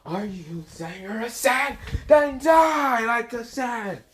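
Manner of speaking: high, stretched-out outraged villain voice; Frio's villain rant. Acted. Frio raging at Boruto from the building, high villain cry. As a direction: rage in a high, theatrical villain pitch. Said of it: "Lord Frio smashes into a building and saying".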